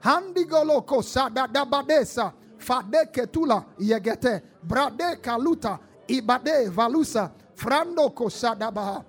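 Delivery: fervent, loud tongues prayer. Acted. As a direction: pray in tongues at full force.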